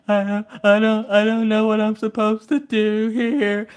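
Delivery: slow, mock-weepy kid voice; Maggie. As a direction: imitate a child blubbering over homework. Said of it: "What's wrong with you now?".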